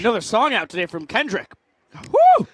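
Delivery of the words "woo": loud, high celebratory whoop. Real, not acted. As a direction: let out a big whoop.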